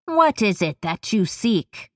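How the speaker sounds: put-on coarse, crackling old witch shout. A narrator's reading. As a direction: call out like a rasping crone. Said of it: "a coarse, crackling voice shouts out".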